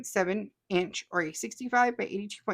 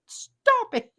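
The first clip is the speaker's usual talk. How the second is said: high, laughing stop it; giggling disgust at the blackberry skin; laughing protest; 14 semitones higher, 4 dB louder than usual. Real, not acted. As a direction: beg them to stop through a laugh. Laughing at a gross comment.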